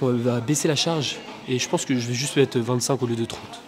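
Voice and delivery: usual speaking voice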